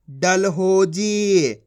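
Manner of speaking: डलहोजी very slow, हो and जी stretched; blended word reading. Shown as a demonstration. Teacher reading the joined word, slow blending. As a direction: blend डल-हो-जी unhurriedly. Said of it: "आपस में लाके पढ़ दो".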